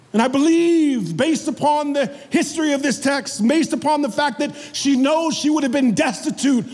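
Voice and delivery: high-pitched, driving sermon delivery; impassioned preaching. Real, not acted. Preacher explaining what Mary feared without Jesus, impassioned preaching. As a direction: preach it in a sustained high register.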